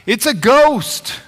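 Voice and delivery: high, loud and slow, panicked sailors; terrified cry. Retold or imitated. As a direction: cry out in fear of a ghost. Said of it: "what do they cry".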